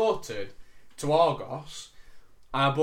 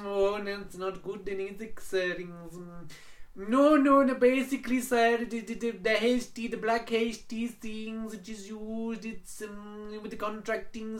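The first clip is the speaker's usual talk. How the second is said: imitated Indian accent; 7 semitones higher, slower than usual. Agent voiced in phone story.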